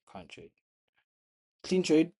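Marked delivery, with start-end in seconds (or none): 1.6-2.1 high loud burst of pride; excited. Real, not acted. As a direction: shout it out, thrilled. Pleased trade comment.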